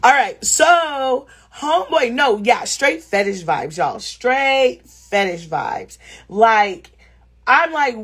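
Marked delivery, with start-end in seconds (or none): 4.0-6.0 drawn out word by word; slow, emphatic. Real, not acted. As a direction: stretch "Straight" long, then pause before "fetish vibes". Repeats it slowly for emphasis.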